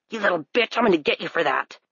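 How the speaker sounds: a mild snarling threat, fast; menacing threat. Acted. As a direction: hiss it out, promising revenge. Stranger threatens after attack.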